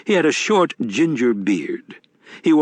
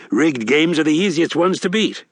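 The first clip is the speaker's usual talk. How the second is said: a touch higher, genial con man; sly old grifter, a bit higher; lightly voiced Wednesday; 6 semitones higher than usual. A narrator's reading.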